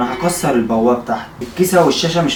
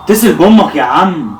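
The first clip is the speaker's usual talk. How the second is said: loud insistent urging; 4 semitones higher, 8 dB louder, slower than usual. Real, not acted. Urging friend to call mother.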